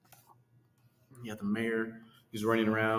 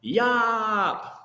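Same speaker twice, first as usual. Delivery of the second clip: loud, high shout; shouted yop; 10 semitones higher, 7 dB louder than usual. Acted. Little boy Jojo shouting from the tower top. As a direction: belt it out from the top. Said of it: "he shouted out".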